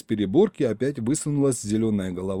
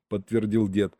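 ordinary delivery

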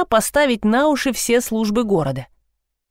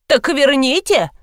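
slow, higher, pouting demand; sulky demand; 7 semitones higher than usual. A narrator's reading. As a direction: demand it with a pout. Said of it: "насупилась пенсионерка".

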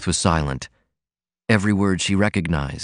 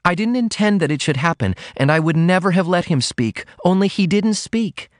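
put-on a higher girlish voice, flustered and earnest; young Ruth, flustered; 10 semitones higher than usual. A narrator's reading. Daughter explaining to her mother, flustered and high. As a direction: rush the confession out high. Ruth's voice held.

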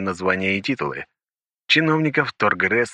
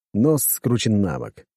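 ordinary delivery, nothing marked

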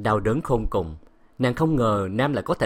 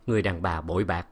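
plain reading voice

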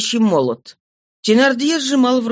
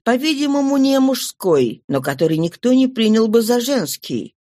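ordinary delivery, nothing marked

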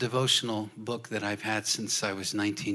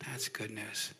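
whispered "That's good news" without voice; 3 semitones lower, 12 dB quieter, slower than usual. Retold or imitated. Repeated as a whisper.

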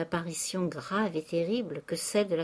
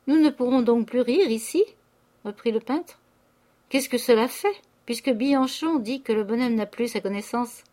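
loud, higher flippant jeer; mocking painter; 4 semitones higher, 6 dB louder than usual. A narrator's reading. Painter mocking Eugène at dinner, flippant loud jeer.

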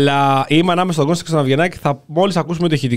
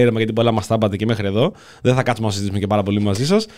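mock dismissive minister; 7 semitones lower, faster than usual. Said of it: "λέει".

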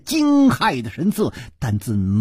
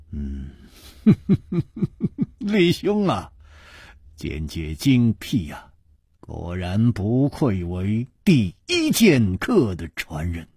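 smiling praise; 7 semitones lower, slower than usual. Acted. Hero praising his friend's sword sense, smiling. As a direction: admire him with a smile. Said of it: "微笑着说".

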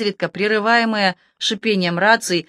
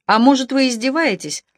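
sudden suspicion; 6 semitones higher than usual. A narrator's reading. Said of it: "осенила её случайная догадка".

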